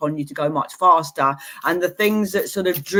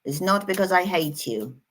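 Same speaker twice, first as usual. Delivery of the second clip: ordinary delivery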